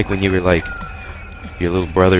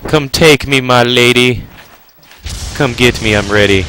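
loud, raised, playful come-hither call; mock surrender call; 5 semitones higher, 5 dB louder than usual. Gamer as Snake inviting the lady to take him, playfully calling. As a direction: call out in hammed-up romantic surrender. Plays out fantasy, calling to boss.

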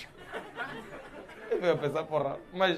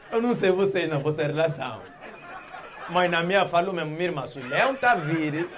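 ordinary talk

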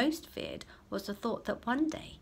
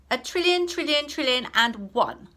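high, loud smug outbid; smug answer; 7 semitones higher, 10 dB louder than usual. Acted. Clever boy topping the class answer, smug and loud. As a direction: top them all, pleased with yourself. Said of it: "clever boy outbids class".

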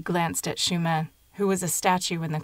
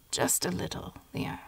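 softer, whispered reply; frail whisper; 6 dB quieter than usual. A narrator's reading. Dying grandmother reassuring the girl, faint whisper. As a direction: answer weakly through the pain.

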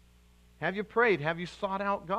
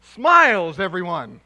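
"Smiles," called out loud; 10 dB louder than usual. Real, not acted. Preacher loudly urging the congregation to smile. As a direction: shout it to the room.

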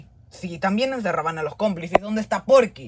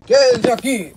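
loud, high guard's command; sharp shouted command; barked order; 4 semitones higher, 6 dB louder than usual. Acted. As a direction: order the prisoners in loudly. Guard orders prisoners into cell.

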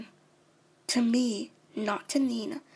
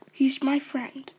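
ordinary talk

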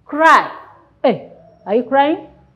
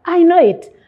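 put-on eager know-it-all child; 6 semitones higher, 6 dB louder, faster than usual. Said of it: "I can see someone saying".

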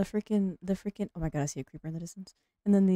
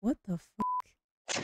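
shocked; 3 semitones higher, 7 dB quieter, much slower than usual. Real, not acted. Shocked at odd request.

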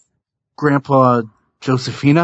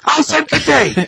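much higher, indignant repeat; Wonka yelling. Retold or imitated. Candy maker repeating his dismissal, high indignant yell. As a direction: insist on it with a furious cry. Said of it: "I feel like you're gonna yell at".

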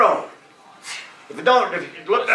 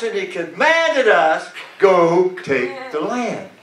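rousing preacher, slowing into the final command; rally the church, phrase by phrase; preaching; 5 semitones higher than usual. Acted. Preacher sending the church to take the land, preaching.

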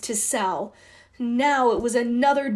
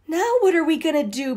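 higher, fast and anxious; worried; 7 semitones higher, 5 dB louder, faster than usual. A narrator's reading. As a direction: panicky question to her sister. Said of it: "Debbie said".

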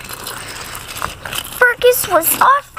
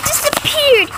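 ordinary delivery, nothing marked

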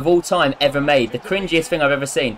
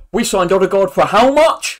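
loud, mock-shocked shout; MGH impression; 5 semitones higher, 8 dB louder than usual. Mocking another YouTuber's video, loud shocked impression. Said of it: "A video where he's like this".

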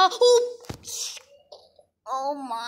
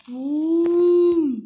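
drawn-out boom; 4 dB louder, much slower than usual. Acted. Jump lands, sound effect.

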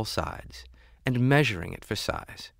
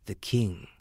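plain reading voice

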